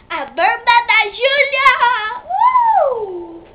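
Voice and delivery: big TV host shout; announcer shout. Pretend. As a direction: belt out the segment title. Announcing her own show segment.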